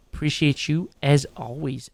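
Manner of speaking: ordinary talk, nothing marked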